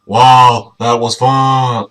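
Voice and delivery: loud slowed wow, drawn out; slow loud cheer. Shown as a demonstration. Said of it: "Ah, fuck, that's not it".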